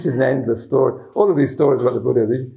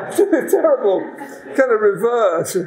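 laughing; 8 semitones higher than usual. Real, not acted. Laughing commentary.

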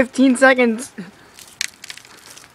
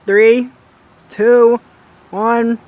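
calling out; 2 semitones higher, 7 dB louder, much slower than usual. Real, not acted. Counting down the challenge start.

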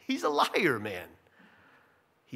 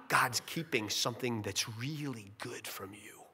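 a softer, unvoiced sly whisper; conspiratorial whisper; 7 semitones lower, 8 dB quieter than usual. Satan whispers the lie to Eve, sly whisper. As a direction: whisper it like a secret temptation.

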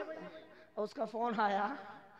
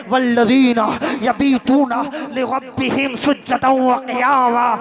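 loud recitation swelling into drawn-out cries; full-force preaching; 3 semitones higher, 16 dB louder, slower than usual. Acted. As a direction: preach it out with ringing, stretched line endings. (पूरे जोश में तक़रीर)

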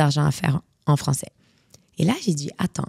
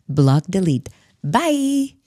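breezy dismissal; 3 semitones higher, 3 dB louder, much slower than usual. Real, not acted. Advising the host on haters, flippantly dismissive. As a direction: wave the haters away, sing-song bye.

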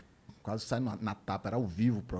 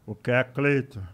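Maguila's voice; 2 semitones higher, 5 dB louder than usual. Retold or imitated. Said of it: "Aí ele".

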